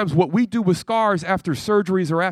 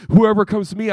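repeated louder; 4 semitones higher, 6 dB louder than usual. Shown as a demonstration.

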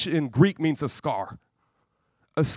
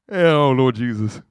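amused sigh; 4 semitones lower, 4 dB louder, slower than usual. Real, not acted. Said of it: "amused exclamation".

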